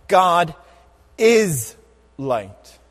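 each word set apart with pauses. Acted. Preacher to congregation, slow word-by-word emphasis. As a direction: space the words out, one at a time. Preacher spacing out key words.

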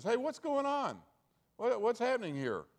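can't believe the passenger hasn't heard; incredulous trucker. Acted. Truck driver to the stranger, high and incredulous.

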